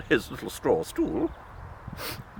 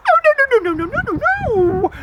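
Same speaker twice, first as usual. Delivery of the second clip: high, loud pleading pig; a frightened piglet begging; squealing little pig; 11 semitones higher, 5 dB louder, much faster than usual. Acted.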